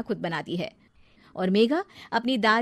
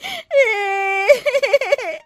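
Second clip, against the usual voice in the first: fake wail; 13 semitones higher, 8 dB louder than usual. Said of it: "रोने का नाटक करने लगती है".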